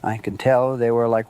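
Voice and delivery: ordinary talk, nothing marked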